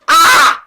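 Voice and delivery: high, loud shriek of rage; furious scream. Acted. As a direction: explode in rage. Dwarf screams.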